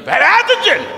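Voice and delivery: high-pitched imitation of a worked-up yeller; mock yelling. Preacher mimicking people bringing him messages, mock yelling. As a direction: yell it as if jerking and shaking. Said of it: "jerking and shaking and yelling".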